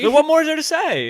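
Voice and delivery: high dramatic. Acted. Mock dramatic sign-off.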